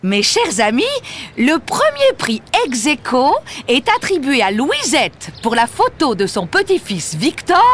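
high-pitched public prize announcement; madame le maire. Acted. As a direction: announce the winner to an assembled crowd. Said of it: "Madame le maire va annoncer le résultat". (annonce solennelle de madame le maire)

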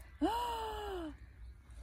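long high falling gasp; a hammed-up gasp of amazement; theatrical gasp. Chocolate bear springs to life.

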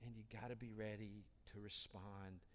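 ordinary talk, nothing marked

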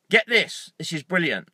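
ordinary delivery

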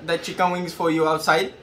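usual speaking voice

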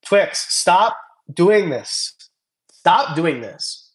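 slow, drawn-out mock telling-off; teasing a fan who keeps sending money, slow mock scolding. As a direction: playfully scold the donor, stretching each word. Scolding a chat donor.

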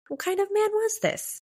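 high-pitched incredulous wondering. A narrator's reading. Teenager wondering what kind of man this is, incredulous. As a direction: pitch it way up in disbelief.

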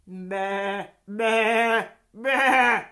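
loud bleats, building in volume; bleating. A narrator's reading. Hen making the sheep's noise to fool robbers, loud bleating. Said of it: "said the fat red hen".

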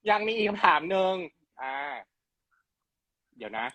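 ordinary delivery

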